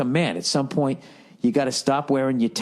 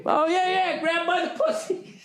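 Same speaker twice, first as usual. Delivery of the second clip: high, giggly sidekick going along with it, laughing through the words; mock Billy Bush laughing along. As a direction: play the eager yes-man, giggling as you agree.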